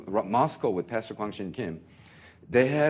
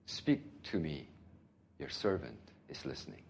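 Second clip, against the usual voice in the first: humble prayer; 7 semitones lower, 11 dB quieter than usual. Pretend. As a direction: pray it gently, surrendering.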